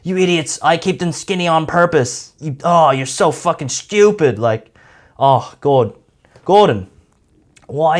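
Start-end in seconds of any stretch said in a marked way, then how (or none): 0.0-4.4 defensive whining. Retold or imitated. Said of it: "defend himself by saying".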